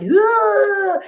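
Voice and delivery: loud, high strained "ugh"; comic squawk through a tightened neck; silly funny-face noise. Shown as a demonstration. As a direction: make a goofy straining groan. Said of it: "get silly and you make a funny face".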